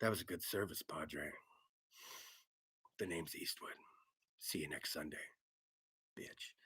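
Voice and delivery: low, whispered tough-guy rasp; low whispered Eastwood impression; gravel tough-guy voice. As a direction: growl it like a movie hard man. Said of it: "do you go".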